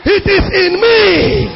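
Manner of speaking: high, stretched-out preaching cry; impassioned cry. Real, not acted. Pastor declaring his calling to preach, impassioned high cry. Preacher's climax, then fading repeats.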